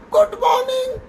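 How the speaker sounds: a high, small, timid good morning; timid mumble. Retold or imitated. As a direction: say it like a nervous boy afraid to speak. Shy trainee forced to greet. (डरा-सहमा धीमा good morning)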